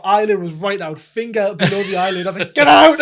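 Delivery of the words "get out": a high, loud hammed-up yell; much higher, much louder laughing yell; mock panicked shout. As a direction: shout it at the stuck plastic. Retold trying to pull plastic out.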